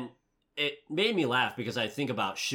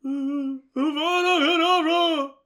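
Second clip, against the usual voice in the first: high, loud, slow drawn-out curse; Ralph Fiennes as Voldemort; 8 dB louder, slower than usual. Retold or imitated. Imitating Voldemort casting the killing curse, loud and slow. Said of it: "that was a good Ralph Fiennes imitation".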